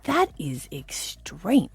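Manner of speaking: very slow, half-whispered disbelief; appalled emphasis. Retold or imitated. Host reacting to friends' constant aircon, appalled. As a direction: stretch each word out in dismay.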